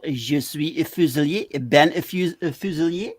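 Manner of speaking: playful French pronunciation; lightly put-on French accent. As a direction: introduce yourself as if French. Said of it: "it sounds really French".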